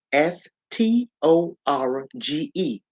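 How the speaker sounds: ordinary delivery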